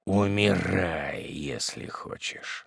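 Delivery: slow, soft cruel indifference; cold demon. A narrator's reading. Demon hermit tells the widow to die, slow and cold. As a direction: let her die, coldly.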